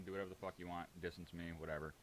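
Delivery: ordinary talk, nothing marked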